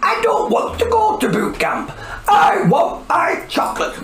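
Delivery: high crying shout; whiny protest. Acted. Princess refusing boot camp, high crying shout. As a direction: wail like a spoilt child.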